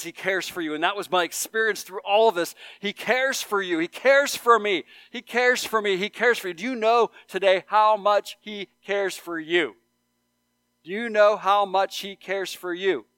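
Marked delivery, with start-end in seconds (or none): 2.8-13.1 higher, louder, ringing sermon climax; fervent preaching. Real, not acted. Pastor urging his congregation, loud fervent preaching. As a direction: drive the promise home to the room.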